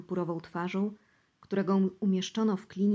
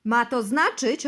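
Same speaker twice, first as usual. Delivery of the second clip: loud and slow, every word pressed out; agitated reply; 4 semitones higher, 7 dB louder than usual. Acted. Bosy answers the interrogator, loud and agitated. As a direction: insist with rising panic. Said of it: "odpowiedział".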